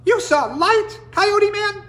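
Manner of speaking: very high tiny disbelieving voice; incredulous little voice. Acted. Hummingbird can't believe coyote saw light, tiny and high. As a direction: ask in amazed disbelief. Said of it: "The little voice was incredulous".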